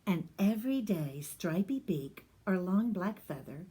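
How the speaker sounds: ordinary delivery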